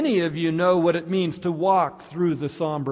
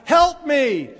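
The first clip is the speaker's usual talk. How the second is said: high, loud mock panicked call; crying out for help; 8 semitones higher, 6 dB louder than usual. Little boy to anyone near, crying out. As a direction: call out like a drowning boy, hammed-up. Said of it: "he's crying out".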